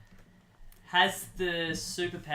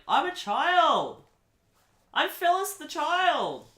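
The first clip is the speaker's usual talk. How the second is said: put-on much higher, slow childish insistence; little child voice; 12 semitones higher, 7 dB louder, slower than usual. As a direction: squeak it like an indignant little kid. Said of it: "she's like".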